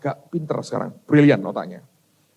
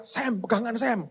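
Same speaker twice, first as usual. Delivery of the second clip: retold warning yell; 5 semitones higher, 6 dB quieter than usual. Father warning his son on a moving boat, mock yelling. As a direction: call out urgently with the volume held back. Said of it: "saya yang teriak-teriak".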